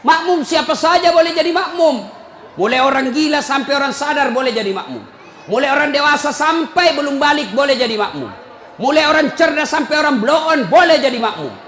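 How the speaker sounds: loud, high-pitched preaching; ceramah berapi-api. Real, not acted. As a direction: hammer out each clause at full force. Preaches at full force. (berkhotbah lantang dengan nada tinggi)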